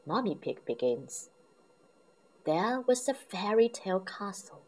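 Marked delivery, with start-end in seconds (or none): none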